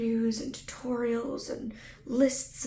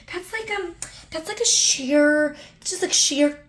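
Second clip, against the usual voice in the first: mom voice, hesitant; 6 semitones higher, 5 dB louder than usual. Retold or imitated. Voicing mom describing colors.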